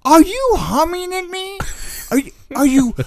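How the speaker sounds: high, indignant mock yell; incredulous outrage. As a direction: squawk it in utter disbelief, far above normal pitch. Director's outraged disbelief, acted out.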